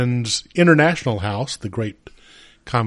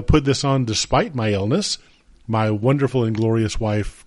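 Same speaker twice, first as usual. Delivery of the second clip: ordinary talk, nothing marked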